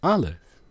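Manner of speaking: Aleph spoken clearly, ah-lef; Aleph said slowly. Shown as a demonstration. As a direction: Aleph modelled for the class as ah-lef. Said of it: "the name of the letter is Aleph".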